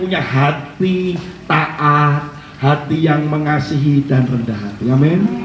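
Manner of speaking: slow, low, drawn-out sermon close; solemn preaching. Acted. Preacher telling the church to stay humble, slow and solemn. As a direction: lean into the blessing slowly.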